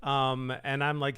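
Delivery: ordinary talk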